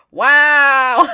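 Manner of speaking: sarcastic wow. Retold or imitated. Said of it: "Grimlock's like".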